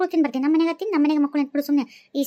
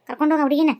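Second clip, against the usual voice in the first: usual speaking voice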